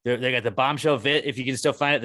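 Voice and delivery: ordinary talk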